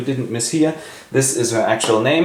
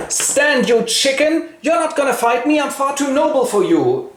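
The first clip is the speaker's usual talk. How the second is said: much higher, hammed-up noble braggart; a pitched-up comic swordsman; mock duel challenge; 12 semitones higher than usual. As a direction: squeak out a pompous challenge.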